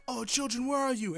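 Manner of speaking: put-on high, anxious call for the children; a lightly voiced frantic hero searching the field; worried hero calling out. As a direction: call out high for the missing kids. Acting out a character's call.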